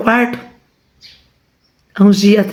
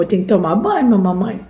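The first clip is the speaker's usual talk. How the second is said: voicing the dog; 3 semitones lower, 4 dB quieter, faster than usual. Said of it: "Fala pro povo".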